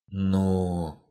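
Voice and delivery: hesitant. A narrator's reading. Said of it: "нерешительно согласилась беглянка".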